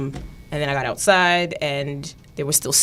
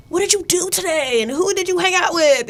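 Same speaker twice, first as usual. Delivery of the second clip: high, prying questions; nosy high voice; 11 semitones higher than usual. Retold or imitated. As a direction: pester like a nosy friend. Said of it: "people were like".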